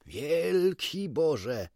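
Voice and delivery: slow, drawn-out cry of amazement; astonished exclamation. A narrator's reading. Doctor hearing unexpected birdsong, astonished exclamation. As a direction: raise the pitch and stretch it in wonder. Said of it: "zawołał doktor".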